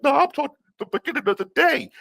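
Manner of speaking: high mocking voice of the coworker, rushed at the end. Coworker scolding the busboy, high mocking impression. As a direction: sneer it in a high, snippy coworker voice. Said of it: "And she's like".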